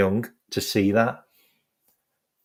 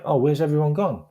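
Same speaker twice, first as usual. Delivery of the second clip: higher-pitched puzzled question; wondering aloud, pitched up; retold puzzlement; 7 semitones higher than usual. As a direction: imitate a puzzled manager. Retold manager finds nobody there.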